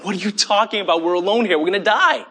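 high, rushed mock panic; panicked disbelief. Surrounded servant answers Elisha's reassurance in panicked disbelief. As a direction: protest like a doomed, frantic servant.

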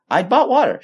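high-pitched insistent retort; insistent wife. Retold or imitated. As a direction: push back, pitch jumping up. Wife insists in retold argument.

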